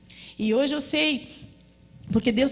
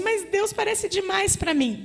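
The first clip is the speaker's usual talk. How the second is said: timid objection; 10 semitones higher, slower than usual. Acted. Daughter doubts God's call.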